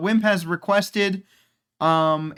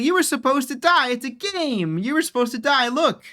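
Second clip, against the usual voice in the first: higher, hammed-up insisting villager; mock insistent son; 7 semitones higher than usual. The son insisting to the player that he should have died, in a mock whine. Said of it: "And he's like".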